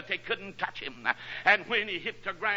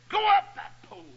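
high, loud cry of a desperate command; shouted order; 13 semitones higher, 7 dB louder than usual. Retold or imitated. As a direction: bark it up the pole like a sergeant under fire. Sergeant orders soldier up pole.

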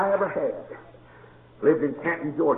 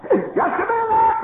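laughing shout; 5 dB louder, slower than usual. Retold or imitated. Boy frightened.

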